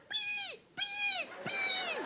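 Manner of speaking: very high squeaky beeping; high dolphin squeaks; dolphin beeps. Retold or imitated. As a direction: chirp like a dolphin. Dolphin answers.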